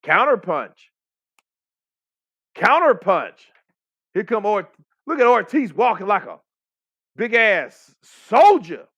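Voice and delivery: high, loud live fight call; hyped play-by-play. Real, not acted. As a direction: call it out like an excited commentator.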